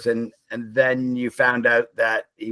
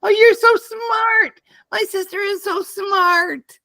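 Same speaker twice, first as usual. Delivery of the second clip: very high, drawn-out teasing praise; mock gushing; 4 dB louder, slower than usual. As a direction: gush slowly in a silly high voice. Mock gushing over sibling's cleverness.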